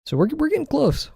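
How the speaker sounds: held high sing-song line. Real, not acted. Cheering on drawing progress.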